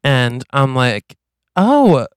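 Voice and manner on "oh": lighter demeanor. Acted. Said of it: "my demeanor is different".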